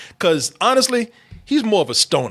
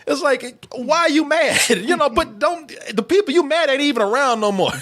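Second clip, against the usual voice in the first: normal talking voice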